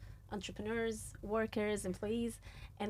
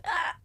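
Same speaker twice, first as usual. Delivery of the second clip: mock outburst; 7 dB louder than usual. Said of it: "automated response is".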